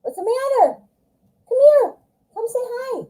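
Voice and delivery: high sing-song voice for the pets; pet talk. Real, not acted. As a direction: coo at the dog. Talking to her pets.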